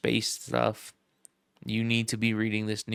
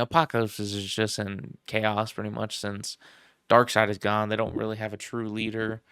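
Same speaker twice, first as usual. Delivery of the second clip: ordinary talk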